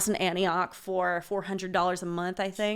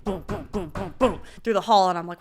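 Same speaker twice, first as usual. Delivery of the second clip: usual speaking voice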